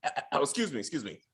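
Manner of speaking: low, unvoiced burnt-lungs rasp; raspy whisper. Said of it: "Lulu's like".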